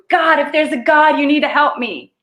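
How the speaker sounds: high, loud cry to heaven; desperate plea. Acted. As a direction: beg God for help, hammed-up. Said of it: "makes you look up and go".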